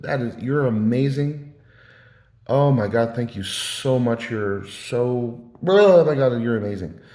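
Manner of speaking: drawn-out, hammed-up gushing; babying flattery. Speaker pretending to answer his emotional addictions, gushing. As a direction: pile on mock amazement, surging on the exclamations. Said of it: "I could have babied him".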